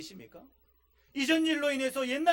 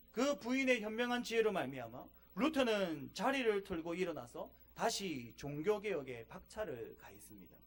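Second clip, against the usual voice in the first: normal talking voice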